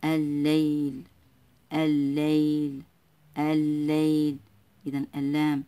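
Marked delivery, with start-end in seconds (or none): 0.0-4.4 الليل very slow, one long doubled l; silent lam. Shown as a demonstration. Teacher showing pupils the sun lam, الليل slow with held l. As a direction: hold the doubled l in الليل, stretching the word out. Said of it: "غير منطوقة".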